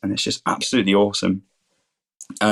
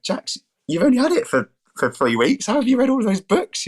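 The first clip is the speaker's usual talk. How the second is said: much higher, incredulous teasing; mock amazement; 13 semitones higher than usual. Teasing Jax for reading so many books, high mock amazement. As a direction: gasp it out as if astounded.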